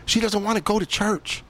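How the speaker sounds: a lightly voiced tempter in his head; tempting inner voice. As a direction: play the voice that lets you off the hook. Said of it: "One side of me is saying".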